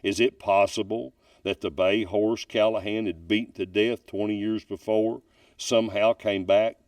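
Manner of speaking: measured storyteller voice, leaning into the dark details; ghost story narration. Acted. Storyteller reading a Kentucky ghost story to his audience, measured and ominous. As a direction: tell it like a campfire ghost tale.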